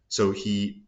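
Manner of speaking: ordinary delivery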